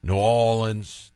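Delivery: New Orleans squeezed to Naw-lins, drawn out; local pronunciation. Shown as a demonstration. Host giving the locals' way, New Orleans as N'awlins. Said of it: "the way the locals do it".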